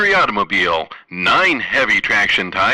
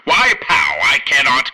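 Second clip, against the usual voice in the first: captain voice. Acted. Captain character voice in sketch.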